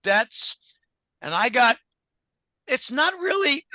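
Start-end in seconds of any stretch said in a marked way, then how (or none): 2.7-3.6 strained voice giving out mid-phrase; losing voice. Real, not acted. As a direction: voice cracking high from a sore throat. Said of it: "losing my voice".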